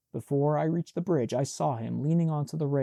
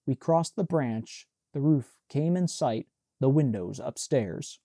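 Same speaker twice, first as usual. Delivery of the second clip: plain reading voice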